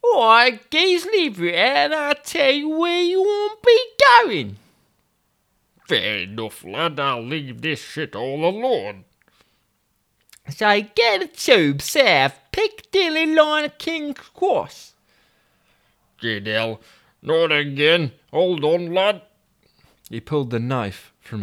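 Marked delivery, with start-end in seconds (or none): 0.0-4.6 put-on high-pitched young boy. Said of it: "therefore high-pitched voice". 5.9-9.0 a put-out northern man, lost in London; Toby grumbling in Yorkshire voice; grumpy Yorkshireman Toby. Acted. 10.5-14.9 put-on much higher, slow London boy's voice; high-pitched young boy. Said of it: "therefore high-pitched voice". 16.2-19.2 higher Yorkshire voice, indignant; a put-out northern man, lost in London; Toby grumbling in Yorkshire voice; grumpy Yorkshireman Toby. Acted.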